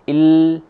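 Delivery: "il" with the l pulled by the i, loud and high; exaggerated wrong il. Shown as a demonstration. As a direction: stress the i in "il" too much. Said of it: "tidak kemudian menjadi".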